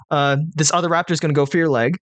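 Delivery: ordinary talk, nothing marked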